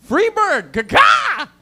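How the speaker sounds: high, loud holler; comic outburst. Acted. Comic hollers after admitting he is single, high shout. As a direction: yell it like a bird call. Sudden yelled outburst on stage.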